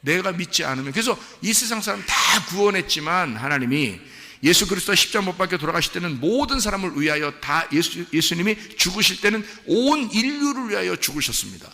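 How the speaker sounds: high, loud sermon voice; forceful preaching. Real, not acted. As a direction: drive the point home to the congregation.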